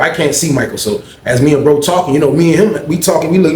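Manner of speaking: usual speaking voice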